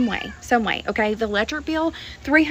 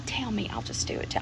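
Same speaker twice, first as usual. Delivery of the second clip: whispered aside; 9 dB quieter than usual. Real, not acted. Joking aside about dealing.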